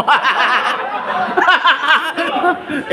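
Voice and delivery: long high cackle; long high laughter. Real, not acted. Long laugh after tease. (mahabang mataas na tawa)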